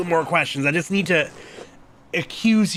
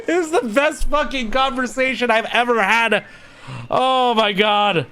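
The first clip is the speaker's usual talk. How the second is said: high, thrilled outburst; elated exclamation; real delight; 7 semitones higher than usual. Real, not acted. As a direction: gush over the scene, voice raised. Player delighted by the game's dialogue.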